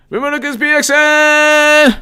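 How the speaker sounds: high, loud call to a viewer; shouted shout-out. Real, not acted. Host calling out a viewer's name, loud and high.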